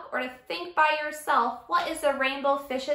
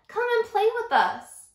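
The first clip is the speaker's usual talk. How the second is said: high, eager invitation; inviting call; 10 semitones higher, 4 dB louder than usual. Acted. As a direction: call him over to play. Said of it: "they would call".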